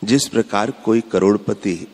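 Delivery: ordinary talk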